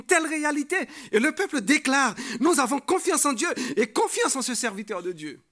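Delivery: loud, high, ringing declaration; full-force preaching. Acted. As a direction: proclaim it for a whole people. Preacher declares the people's faith. (prédication à pleine voix)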